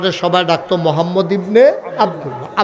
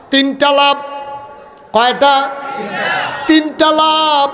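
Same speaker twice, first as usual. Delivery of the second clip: high, slow emphatic shout; drawn-out preacher's shout; 6 semitones higher, slower than usual. Real, not acted. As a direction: call it out to the whole crowd. Preacher calling out to crowd.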